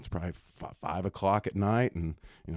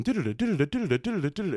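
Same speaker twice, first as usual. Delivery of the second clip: tape fast-forward noise; 6 semitones higher, much faster than usual. Retold or imitated. Imitating fast-forwarding tape sound.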